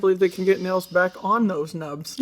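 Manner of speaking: normal talking voice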